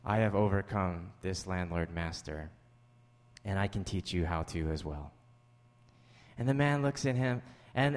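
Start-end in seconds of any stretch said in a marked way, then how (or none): 0.0-5.1 mysterious mentor. Retold or imitated. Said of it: "and says".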